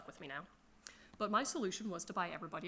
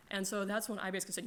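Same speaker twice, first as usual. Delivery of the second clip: ordinary talk, nothing marked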